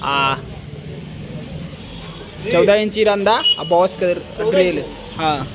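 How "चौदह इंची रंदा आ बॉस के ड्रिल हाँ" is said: ordinary talk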